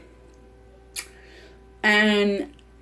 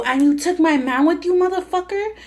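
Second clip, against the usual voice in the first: retold angry yell; 8 semitones higher than usual. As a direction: replay the confrontation as a hammed-up shout, pitch climbing on motherfucker. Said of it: "I was so pissed at him".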